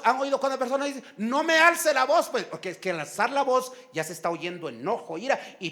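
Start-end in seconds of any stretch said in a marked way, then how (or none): 1.2-2.3 slow, higher-pitched mock rebuke; raised-voice rebuke. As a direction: act out someone objecting, voice lifted. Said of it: "Cuando alguien alza la voz". (reclamo con la voz alzada, actuado)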